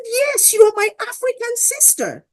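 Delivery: very high-pitched, emphatic retold reply; imitated insistent man. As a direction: act out his eager claim of kinship. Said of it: "He said".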